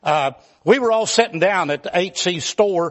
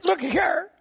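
whiny patient; 9 semitones higher than usual. Retold or imitated. Arzy whining to doctor.

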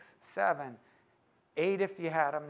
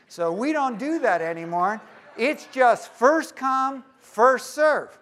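suddenly louder and higher, drawn-out; hammed-up shopkeeper brushing him off; pie man's refusal; 6 semitones higher, 10 dB louder, slower than usual. Pie shop owner refusing, acted.

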